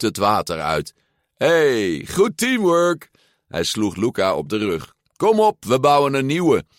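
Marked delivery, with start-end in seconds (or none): 1.3-3.1 high, drawn-out cheer; exuberant. A narrator's reading. Thrilled boy praises his friend. 5.2-6.6 loud, high, enthusiastic call; rally your friend for another go; eager rallying. A narrator's reading. Eager boy rallies his friend.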